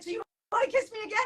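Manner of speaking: fast, high eager question; eager friend. Retold or imitated. The friend hoping for another kiss, fast and high. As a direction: rush it out hopefully.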